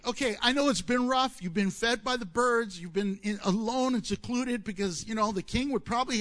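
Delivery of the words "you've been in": ordinary talk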